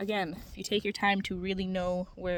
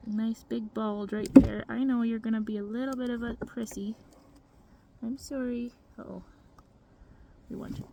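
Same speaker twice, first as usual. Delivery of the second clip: usual speaking voice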